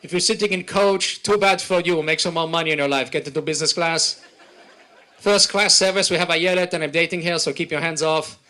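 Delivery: swagger through the announcement like the boss of the plane; brash Israeli pilot. Retold or imitated.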